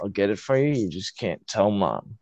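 low, soft conspiratorial dad voice; hushed dad. Retold or imitated. Dad tells his kid to keep it from Mom, hushed and low. Dad's secret offer retold.

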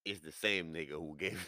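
normal talking voice

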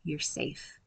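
put-on softly whispered comfort to a child. Shown as a demonstration. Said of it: "I'll just whisper to them".

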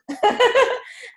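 a sudden high laugh at something absurd; amused laugh. Real, not acted. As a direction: laugh it off as a joke. Host laughs at the idea.

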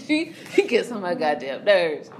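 ordinary talk

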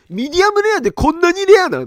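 high, loud incredulous question; stunned that medium rare is this rare; shocked disbelief. Retold or imitated. Diner shocked by the rare steak, high and loud disbelief.

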